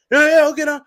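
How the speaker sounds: high, loud eager reply; mock gamer kid. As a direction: squeak it out like a keen kid. Kid answering friend online.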